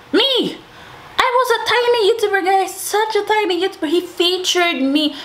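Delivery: high, fast, thrilled squeal; excited. Retold or imitated. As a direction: gush with disbelief. Thrilled about being featured.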